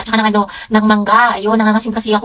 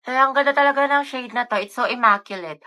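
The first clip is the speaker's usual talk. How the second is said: normal talking voice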